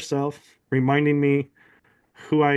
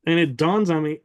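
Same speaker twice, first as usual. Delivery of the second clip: ordinary talk, nothing marked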